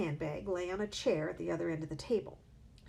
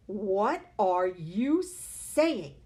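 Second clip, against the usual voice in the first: very slow, louder alarmed cry; 9 dB louder, much slower than usual. A narrator's reading. Husband crying out at his wife's warning, slow and loud. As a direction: draw it out in alarm. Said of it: "the husband cried".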